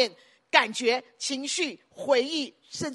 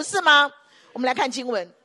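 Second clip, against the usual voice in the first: normal talking voice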